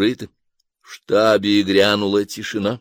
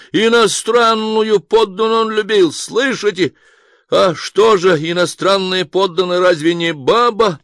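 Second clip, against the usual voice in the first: high, loud cheeky crow; cocky retort; 10 semitones higher, 5 dB louder than usual. A narrator's reading. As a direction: strut like a rooster, brazen. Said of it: "Петушком вскинулся обнаглевший". (дерзко, петушком, громко)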